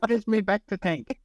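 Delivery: the same hammed-up Kiwi line, pitched a bit up; mock New Zealander, playful; New Zealand accent. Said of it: "an American try to do a New Zealand accent".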